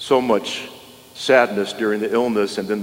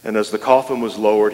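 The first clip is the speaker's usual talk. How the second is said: ordinary talk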